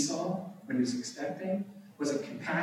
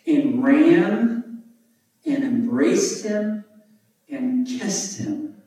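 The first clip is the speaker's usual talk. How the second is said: RAN, EMBRACED, KISSED each slow and raised in pitch; emphatic verse reading; 7 semitones higher, 8 dB louder, much slower than usual. A narrator's reading. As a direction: build on "ran", "embraced", "kissed", with pauses between.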